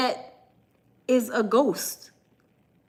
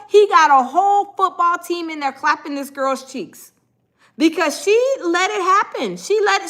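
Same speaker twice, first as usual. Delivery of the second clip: ordinary talk, nothing marked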